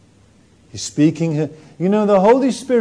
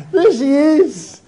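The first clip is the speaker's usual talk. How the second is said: much higher, louder, pestering; prying voice; 10 semitones higher, 5 dB louder than usual. Acted. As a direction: badger her for news. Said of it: "they say to her".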